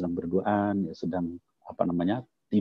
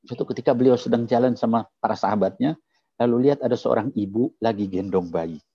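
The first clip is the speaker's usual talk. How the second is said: ordinary talk, nothing marked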